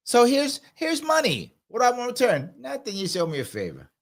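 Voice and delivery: lightly played New York mobster accent; mock mob accent, starting high and dropping away. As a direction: make the offer in a put-on mobster accent. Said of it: "I do a better mob accent".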